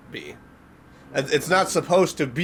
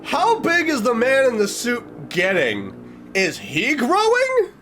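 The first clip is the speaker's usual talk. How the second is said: higher, slow, stretched-out alarm; alarmed disbelief; 9 semitones higher, slower than usual. Real, not acted. Reacting to the creepy photo, alarmed and high. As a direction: gape at the photo as it gets worse.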